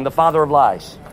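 normal talking voice

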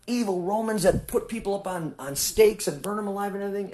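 high-pitched, indignant mimicry of pious objectors; put-on high voice of scandalized complainers; mock indignation. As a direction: voice their outraged question, pitched well up.

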